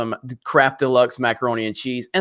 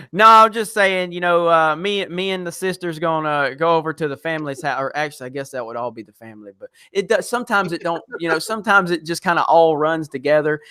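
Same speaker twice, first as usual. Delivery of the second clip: put-on Southern accent. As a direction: tell it like a Southern good old boy. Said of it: "with your Southern accent?".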